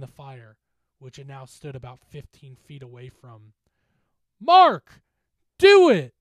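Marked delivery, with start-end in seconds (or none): 4.4-6.1 very high, very loud urging; frantic scream. Acted. Johnny screaming at his friend as the specter approaches. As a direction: scream his name to spur him on. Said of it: "Johnny screamed".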